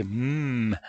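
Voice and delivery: M as a held hum, mmm; letter sound M. Shown as a demonstration. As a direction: hum M with lips closed. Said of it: "on fait M". (M prononcé « mmm », tenu)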